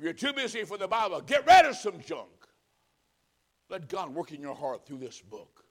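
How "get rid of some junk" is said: much louder and higher, hammering it home; preacher's sudden loud push; forceful exhortation. Real, not acted. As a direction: bark the command to the congregation.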